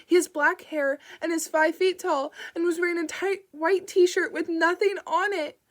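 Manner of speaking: a mother breaking down on the phone to the dispatcher; starting to cry. Acted. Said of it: "And Vic began to cry".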